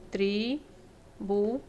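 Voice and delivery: "tributária" drawn out slowly syllable by syllable, leaning on tá; teacher slowly breaking "tributária" into syllables; slow syllable split. Shown as a demonstration. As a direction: spell out "tributária" slowly, tri-bu-tá-ria. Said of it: "a sílaba mais forte é o tá".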